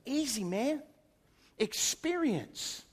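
drawn-out, very slow punchline; wise man's answer. Retold or imitated. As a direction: savour the word Experience slowly. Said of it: "And he goes".

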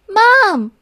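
high, loud call for Mom. A narrator's reading. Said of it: "she called when she was done".

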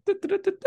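high-pitched "du-du-du" sting; mock news jingle. Host introduces the Florida story, mock news jingle. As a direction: toot out a little fanfare, very high.

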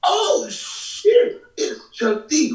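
very high, awed retold gasp of recognition; starstruck exclamation. Friend retelling spotting a hero on the stairs, starstruck. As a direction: squeal it, imitating a stunned fan.

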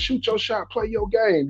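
imitated cheering. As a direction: re-enact urging on a shooter, voice held up high. Retells hollering encouragement at the player.

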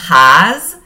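"Ház" loud, with a long open á; Hungarian demo. Shown as a demonstration. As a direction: announce "Ház" clearly.

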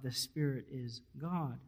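ordinary delivery

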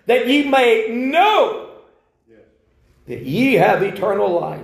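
emphatic scripture reading. Shown as a demonstration. Scripture read a second time.

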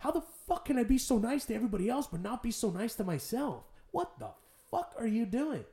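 high-pitched exasperated self-talk; retold pep talk to himself, voice lifted; self-scolding. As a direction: take yourself to task, incredulous. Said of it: "That's the conversation I had with myself".

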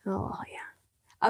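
breathy whispered oh yeah; whispered aside. Real, not acted. Whispered aside after the laughter.

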